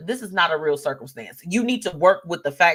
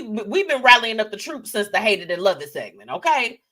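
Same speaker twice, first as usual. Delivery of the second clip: ordinary delivery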